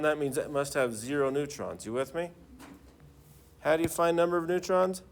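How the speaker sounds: ordinary talk, nothing marked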